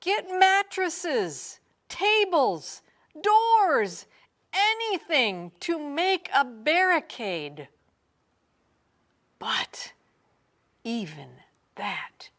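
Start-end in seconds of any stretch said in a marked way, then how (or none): 0.0-7.7 shout. A narrator's reading. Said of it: "shouted the mayor".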